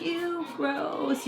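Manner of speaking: lightly voiced child's ew; mock disgusted kid reaction; kids' disgust.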